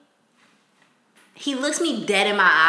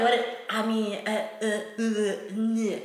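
stumbling; 6 dB quieter, slower than usual. Retold or imitated.

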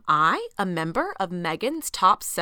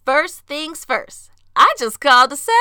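announcer build-up; 6 semitones higher, 10 dB louder than usual. Acted. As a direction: announce the segment title. Announcing the show segment.

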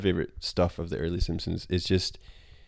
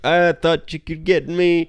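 Grandpa voice; 9 semitones higher, 6 dB louder than usual. Retold or imitated. Grandpa voiced fighting crocodile.

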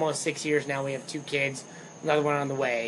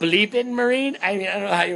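laughing; 5 semitones higher, 5 dB louder than usual. Real, not acted. Laughing joke about censoring.

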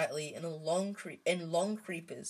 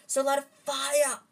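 choking; 8 semitones higher, 7 dB louder than usual. A narrator's reading. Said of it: "Harry choked".

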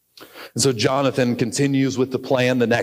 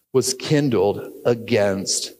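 normal talking voice

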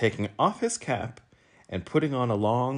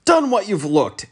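high, loud angry yell; angry shout; 12 semitones higher, 8 dB louder than usual. A narrator's reading. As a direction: shout it at the mess in rage. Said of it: "angrily shouted".

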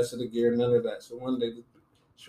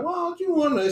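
high suspicious office lady; 4 dB louder than usual. Retold or imitated.

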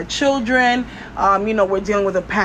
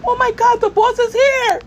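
hammed-up panic; 13 semitones higher, 3 dB louder than usual. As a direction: squeal it like a panicking worker. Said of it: "And you're like".

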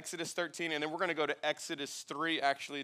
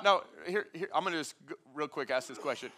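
usual speaking voice

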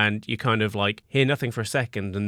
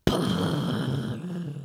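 softer, long fluttering lip noise; imitated rumbling noise; 6 dB quieter than usual. Game master voicing a sudden noise, fluttering rumble. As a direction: make a drawn-out rumble with the mouth. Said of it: "you hear a".

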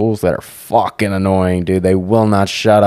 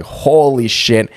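shocked; 5 semitones higher, much slower than usual. Retold or imitated. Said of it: "and I was like".

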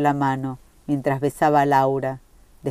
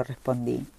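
ordinary delivery

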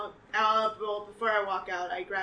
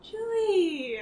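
high, louder cry of her name; joyful reunion; 12 semitones higher, 9 dB louder than usual. Acted. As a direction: call her name, overjoyed. Reunion with long-lost sister.

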